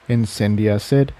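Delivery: ordinary delivery, nothing marked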